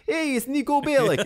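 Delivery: mock Russian accent from an old cartoon; bad Russian accent. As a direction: ham up a terrible Russian accent. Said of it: "I did a terrible ... talking like".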